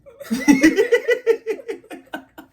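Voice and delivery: loud, high burst of laughter; big high laugh. Real, not acted. Laughing at the spanking story, high and loud.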